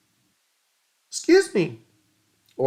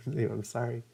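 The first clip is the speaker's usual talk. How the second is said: usual speaking voice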